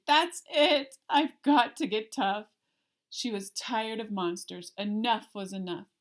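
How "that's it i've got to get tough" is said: louder, fed-up declaration; louder fed-up resolve; determined. Acted. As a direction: put your foot down, sharp and loud. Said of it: "She decided".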